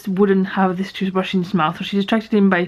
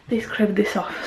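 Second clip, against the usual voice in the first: ordinary talk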